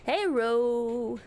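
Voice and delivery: ordinary delivery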